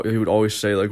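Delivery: ordinary delivery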